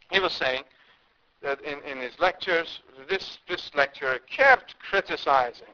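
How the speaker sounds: usual speaking voice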